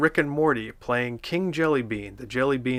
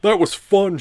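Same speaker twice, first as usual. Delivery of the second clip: high, louder shout as Patrick; 7 semitones higher, 7 dB louder than usual. A narrator's reading. Patrick shouting to SpongeBob how fun it was. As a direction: yell it out happily as Patrick. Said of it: "shouted Patrick".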